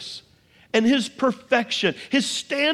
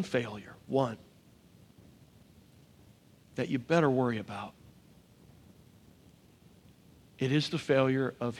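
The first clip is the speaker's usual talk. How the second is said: soft, much lower and quieter closing appeal; hushed altar call; 9 semitones lower, 9 dB quieter than usual. Acted. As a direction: draw them in with a near-hushed plea. Quiet closing appeal, held low.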